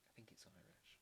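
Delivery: whisper. Real, not acted. Unsure guess about the language.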